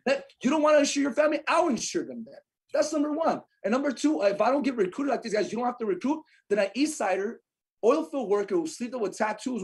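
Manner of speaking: high, emphatic and insistent; fired-up rant. Real, not acted. Agent arguing for insuring families and recruiting, fired up. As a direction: drive each point home with heat.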